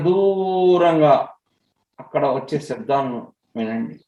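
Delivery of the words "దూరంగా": slow, with a long held vowel in "దూరంగా"; stretched for distance. Real, not acted. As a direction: draw out "దూరంగా" to paint how far off it is. Stretches the word for far away. ("దూరంగా"ను బాగా సాగదీసి పలకడం)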